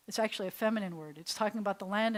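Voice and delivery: normal talking voice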